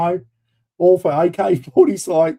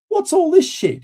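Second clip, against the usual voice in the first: much higher, louder mock outrage; exasperated squawk; 3 dB louder than usual. Warrant officer scanning the ammo list, high exasperated squawk. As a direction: yelp it in retold disbelief.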